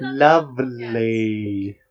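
very slow drawn-out mock British lovely; British accent. Host showing his only British accent. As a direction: stretch it out like a posh Brit. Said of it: "my only British accent".

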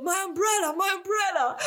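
high, frantic mock cry for the umbrella; imitating mom begging a guide for her umbrella, high and frantic; imitating panicked mother. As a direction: whine it like a lost tourist mom.

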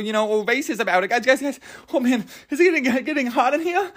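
high, rushed, mock-panicked protest; flustered backpedal. Comic reassuring the listeners he meant only the food, flustered. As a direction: backpedal in a high, tripping, over-defensive rush.

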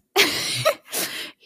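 airy giggle, high up; laughing at the lucky cord; high breathy laugh. Real, not acted. After finding the cord.

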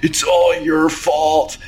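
high-pitched mock-crying accusation; hammed-up weepy villain; tearful blaming. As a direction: wail the blame at them, voice pushed high. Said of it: "and he starts crying".

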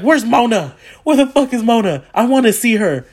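high, loud hammed-up demand; eager demand. Fan demanding to see the actress, hammed-up excitement. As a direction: call out as if searching the crowd. Acted eager search for a celebrity.

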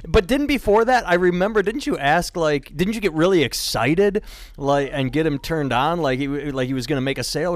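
ordinary delivery

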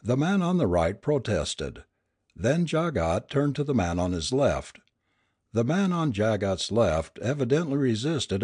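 plain reading voice

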